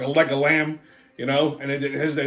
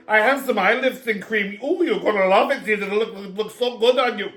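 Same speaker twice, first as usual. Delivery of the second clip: higher, rushed sales patter; mock gushing salesman, pitched up; gushing makeup seller; 4 semitones higher than usual. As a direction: imitate an eager cosmetics pitch. Mimics gushing eye-cream seller.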